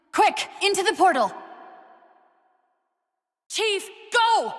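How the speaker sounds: high, loud alarmed call; urgent shout. Acted. Urging the Chief into the portal, alarmed shout. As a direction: yell it, pushing him on.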